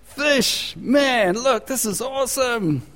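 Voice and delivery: high, gleeful bragging fisherman voice; mock excited fisherman. Fisherman crowing to his mates about the catch, hammed-up excited. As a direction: ham up the delight over a huge catch. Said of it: "What do you expect him to go".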